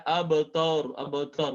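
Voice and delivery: "abtaru" said as abtoru; drawn-out "abtaru" with tor for tar; faulty fathah vowel. Shown as a demonstration. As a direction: round the A of "abtaru" into an O. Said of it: "bukan tor tapi ta".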